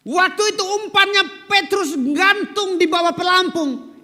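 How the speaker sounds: high, loud, fervent preaching. Acted. Pastor marvels to the congregation about the fish, fervent. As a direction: drive the point home from the pulpit.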